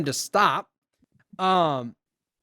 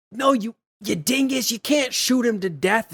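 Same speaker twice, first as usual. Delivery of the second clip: higher-pitched exasperated telling-off, lightly acted; mock scolding; 4 semitones higher than usual. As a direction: imitate an indignant correction. Recapped heroes scold the gunman.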